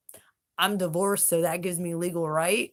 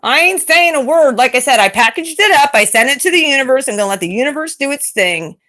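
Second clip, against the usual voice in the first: loud, proud declaration; 7 semitones higher, 12 dB louder than usual. Real, not acted. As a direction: announce it to the whole stream. Mother declares loudly on stream.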